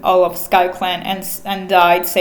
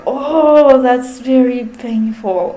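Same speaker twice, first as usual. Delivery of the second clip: wincing reaction; 3 semitones higher, slower than usual. Retold or imitated. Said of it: "I read the scene and I was like".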